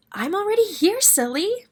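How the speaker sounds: high squeaky mouse voice; high mouse squeak. Acted. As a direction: squeak it, a bit cheeky. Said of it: "Mouse squeaked".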